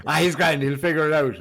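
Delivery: loud, high, breezy mock dismissal; careless brush-off. Friend re-quoting the mates about the stranded van, loud breezy brush-off. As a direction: shrug it off like it's no problem. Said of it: "Maybe something like that".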